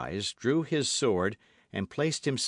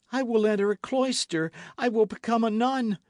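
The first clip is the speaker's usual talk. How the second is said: desperate plea; 13 semitones higher, 5 dB louder than usual. A narrator's reading. Milady pleading for her life.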